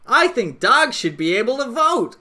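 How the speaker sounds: loud, high put-on goofy voice; mock earnest declaration; Griffin McElroy voice. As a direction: sell it as a silly podcaster. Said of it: "like this".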